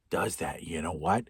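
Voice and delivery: a conspiratorial whispered does that. Acted. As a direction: drop to a hush on the repeat. Repeat dropping to whisper.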